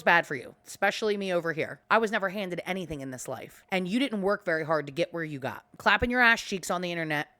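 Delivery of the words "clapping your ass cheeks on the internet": ordinary talk, nothing marked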